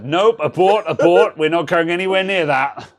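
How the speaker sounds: high, loud call to abort; comic panicked back-off; mock alarm. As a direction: call off the mission, loud and urgent. Mock alarm at a risky joke.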